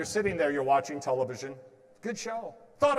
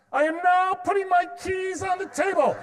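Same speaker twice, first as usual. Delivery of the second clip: much higher, louder, over-clear announcement to the house; loud announcing; 13 semitones higher, 8 dB louder than usual. Retold or imitated. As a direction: proclaim it for everyone to hear. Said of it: "I'd do it just like this".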